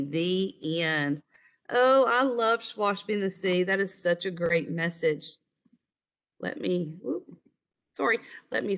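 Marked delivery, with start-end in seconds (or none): none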